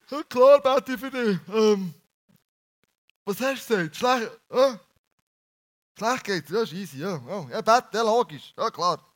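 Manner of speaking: loud, careless mock reply, barely listening; half-hearted, distracted friend. Preacher mocks a careless friend promising prayer, loud and offhand. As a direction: toss it off like you don't care. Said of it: "du machst nicht Folgendes".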